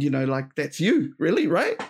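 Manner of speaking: normal talking voice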